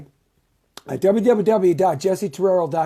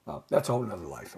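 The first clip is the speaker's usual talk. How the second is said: ordinary delivery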